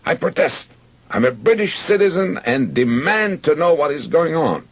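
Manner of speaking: high-pitched indignant outburst; shouted protest. A narrator's reading. Handcuffed British citizen protesting to soldiers, indignant shout. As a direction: protest loudly, voice pitched right up. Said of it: "I shouted in English".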